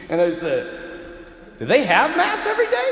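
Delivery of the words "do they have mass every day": high, loud comic disbelief; hammed-up shocked student; incredulous. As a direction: squeal the question, appalled. Student reacts incredulously to the priest.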